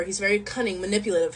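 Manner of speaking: plain reading voice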